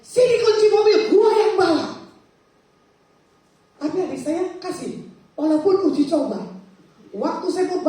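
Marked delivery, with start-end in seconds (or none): 0.0-1.9 high, forceful shout, refusing to back down; insistent, loud demand. Retold or imitated. Arguing with younger sibling over car keys, insistent shout. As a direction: demand it loudly, stubbornly. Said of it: "Sambil saya ngotot sama adik saya".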